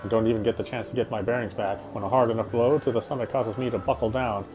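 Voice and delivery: ordinary delivery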